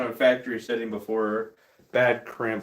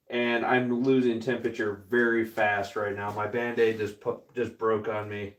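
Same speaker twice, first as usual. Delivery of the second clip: ordinary talk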